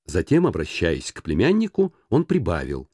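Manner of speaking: plain reading voice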